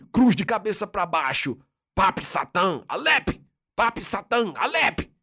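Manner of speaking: high chanted demonic gibberish; speaking in tongues. A narrator's reading. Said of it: "dando a impressão de falar em língua".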